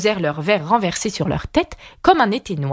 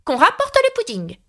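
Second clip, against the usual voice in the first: high-pitched command to the servants; exclaimed order in a high voice; crying out; 12 semitones higher than usual. A narrator's reading. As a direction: call out the order, pitched right up. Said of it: "s'écria".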